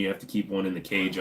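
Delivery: normal talking voice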